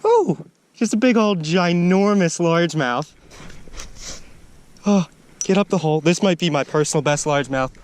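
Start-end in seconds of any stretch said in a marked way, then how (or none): none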